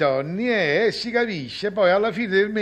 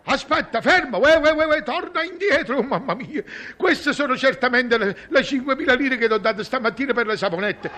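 alarmed calling out; 7 semitones higher than usual. Real, not acted. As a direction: shout after the fleeing son. Father cheated, calling son back.

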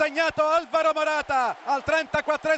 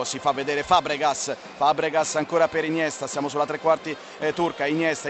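live play-by-play; 10 semitones lower than usual. Real, not acted.

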